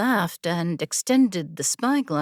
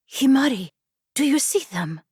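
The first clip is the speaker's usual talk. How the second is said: eager call; 7 semitones higher, faster than usual. A narrator's reading. Kiora eagerly calling her friend to look at the herd, excited. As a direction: call out in thrilled wonder.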